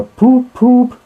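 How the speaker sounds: high, loud blaring poop poop; brazen horn blast. Acted. Motor car horn blares in their ears, loud brazen honk. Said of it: "rang with a brazen shout in their ears".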